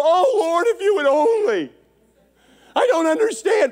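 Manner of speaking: high, loud mock whine to the Lord; mock whining complaint. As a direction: whimper like a spoiled child complaining. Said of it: "we go".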